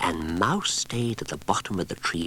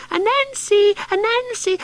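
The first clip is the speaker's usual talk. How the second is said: much higher, louder squeak; frightened mouse voice; squeaky mouse; 8 dB louder than usual. Acted. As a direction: squeak it like a scared little mouse. Call Mouse won't make.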